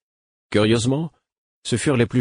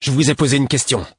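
loud, high, aggressive demand; snarling; 7 semitones higher, 5 dB louder than usual. Acted. Young guard snarling at a stranger, loud and high. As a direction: bite the words out at him. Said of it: "lança-t-il hargneux".

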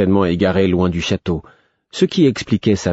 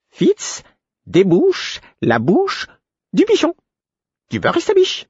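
very high, slow, separated words; exasperated, drawn-out fluting repetition; clear, annoyed piping; slower than usual. A narrator's reading. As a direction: spell it out for an idiot in a high voice. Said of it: "d'une voix claire où perçait l'agacement".

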